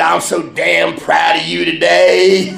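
loud, slow, high impression of a proud father; mock-emotional proud shout; imitating tearful dad. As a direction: belt it out slowly as the overcome dad. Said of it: "He was crying".

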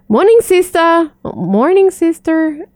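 imitating an American street greeting, pitched high; cheery high American "Morning, sister"; American accent. Passers-by greeting the nun in Washington, imitated American accent. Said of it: "they say it with an American accent".

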